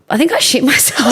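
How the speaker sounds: high, breaking into laughter; laughing. Real, not acted. Confessing the accident to friends, real laughter. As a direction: get the line out, then crack up.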